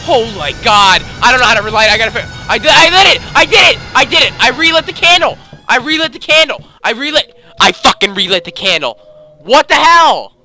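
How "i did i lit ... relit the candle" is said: high, loud victory shout; triumphant shouting. Real, not acted. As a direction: yell with relief and triumph. Triumph after relighting candle.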